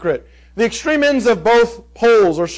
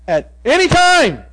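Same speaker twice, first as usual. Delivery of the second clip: "at any time" lifted higher and louder, slow; stress on time; 6 semitones higher, 4 dB louder than usual. Shown as a demonstration. Preacher pointing out the son's boast. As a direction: lean on "at any time" slowly.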